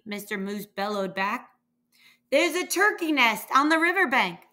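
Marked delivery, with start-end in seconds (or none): 2.3-4.5 calling out. A narrator's reading. Sheep telling the moose where the nest is, calling. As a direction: call it down, higher and louder. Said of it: "Sheep called".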